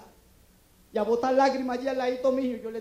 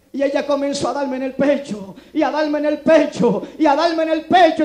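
each repeat louder than the last; building repetition; 10 dB louder than usual. Acted. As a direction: pound the phrase harder every time like the chest blows. Repite la frase creciendo en volumen. (repetición creciente, cada vez más fuerte)

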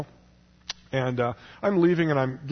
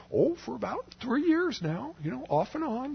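Bob; 12 semitones higher, 4 dB quieter than usual. Retold or imitated. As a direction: draw it out high, like a little old man. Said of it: "He's like".

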